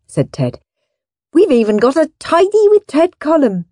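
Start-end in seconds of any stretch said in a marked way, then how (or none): 1.3-3.7 high-voiced proud little boy; a child showing off; proud boast. A narrator's reading. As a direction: announce it proudly, pitched up. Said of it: "he added proudly".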